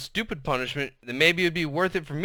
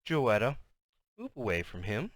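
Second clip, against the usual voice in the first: very soft, low, sinister order; hushed menace with an eerie lilt; snake woman voice; 4 semitones lower, 8 dB quieter than usual. A narrator's reading. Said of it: "the snake woman voice came back".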